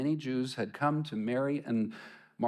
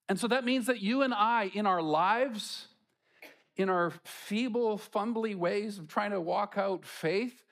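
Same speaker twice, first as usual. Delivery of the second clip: preaching at full force; 7 semitones higher than usual. Real, not acted. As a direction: drive the point home to the congregation. Preaching climax.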